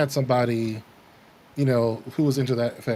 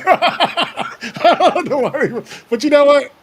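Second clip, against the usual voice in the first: high, slow laughing words; 13 semitones higher, 7 dB louder, slower than usual. Real, not acted. Laughing at own story.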